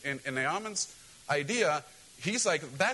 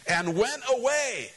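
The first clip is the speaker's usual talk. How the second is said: dramatic reading; 7 semitones higher, 4 dB louder, slower than usual. Shown as a demonstration.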